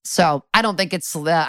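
normal talking voice

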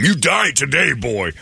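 mock witch threat, high; hammed-up spooky crone; lightly voiced old witch; menacing witch. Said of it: "he thought she was like".

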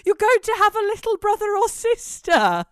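high, loud, excited pet voice; talking to dogs. Retold or imitated. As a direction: announce big news to the dogs. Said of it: "he turned to the dogs and went".

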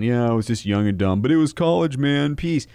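high, loud dopey student voice; mock college kid. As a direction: play a careless kid shrugging it off. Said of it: "Do you really want to have that regret".

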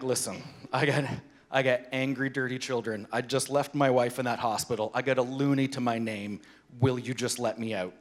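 low, quiet, deadpan appeal; imitating a worn-out dad's muted plea; weary plea. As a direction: plead low and spent with the gate attendant. Weary plea to gate man.

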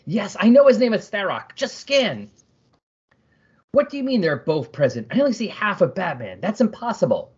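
high, exasperated hero voice; Blue Beetle. Acted. Reader voicing a hero arguing with his armor, high and exasperated. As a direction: argue back in a high, impatient voice.

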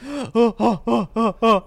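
loud, high mock sobs; heaving, hammed-up sobbing; big man bawling, imitated; imitated weeping. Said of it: "He was weeping".

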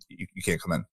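low, soft imitated brush-off; quiet refusal. Gym member turning away a stranger, quiet refusal. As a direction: mumble a hesitant refusal, low and under your breath.

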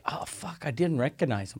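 whispered start, very soft finish; hushed realization. Retold or imitated. House seller realizing who the buyer is, hushed realization. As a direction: mutter it under your breath, as a retold aside.

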